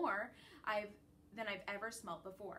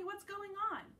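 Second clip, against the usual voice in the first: ordinary delivery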